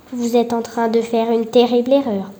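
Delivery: ordinary delivery, nothing marked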